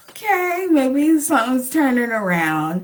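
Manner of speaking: high, lifted voice of hopeful surprise; a pitch-up of sudden hope at the roses. Retold or imitated. To herself, seeing roses on the table: hopeful, high surprise.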